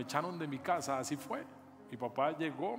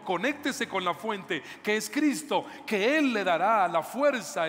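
full-force preaching. Acted. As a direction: preach it, urging the congregation to connect. (predicando con fuerza, más alto y lento)

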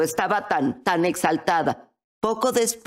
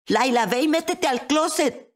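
mock yell, pitched up; excited call; 8 semitones higher than usual. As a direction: call out an eager order. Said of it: "iba a gritar".

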